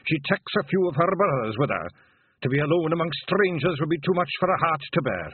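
put-on lightly Scottish old bard; a hint of an old Scots piper; Feegle Gonagle voice. A narrator's reading. William the Gonagle speaking.

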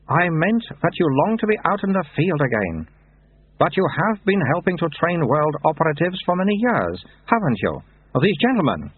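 put-on high, childlike abbot voice; baby abbot. A narrator's reading. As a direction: speak like a toddler with an old man's words.

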